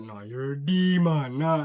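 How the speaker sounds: slow, drawn-out joking dismissal; mock disgust. Clowning a homie after something weird, slow mock disgust. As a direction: stretch it out like you're done with him. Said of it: "Like, it's a joke".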